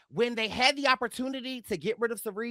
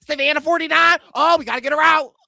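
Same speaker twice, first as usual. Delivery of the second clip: higher, loud imitated outburst; mock urgent reply; 4 semitones higher, 8 dB louder, faster than usual. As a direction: act out a sudden alarmed decision, loudly. Acted tribe turning on another player.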